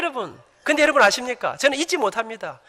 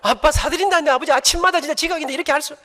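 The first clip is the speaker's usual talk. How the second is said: son's loud protest; 6 semitones higher, 6 dB louder than usual. Retold or imitated. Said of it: "son's reply".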